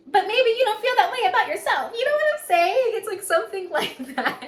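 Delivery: high, loud, breaking into a laugh. Real, not acted. Telling Leo they don't feel like marriage material, high laughing. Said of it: "I'm laughing because I've been here".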